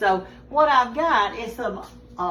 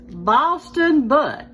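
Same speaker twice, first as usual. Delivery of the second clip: louder, each word drawn out slowly; slow, emphatic announcement; 3 semitones higher, 7 dB louder, much slower than usual. Retold or imitated. Showing the Yankee visitor the label, slow loud triumph. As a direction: land it like a triumphant reveal, slowly.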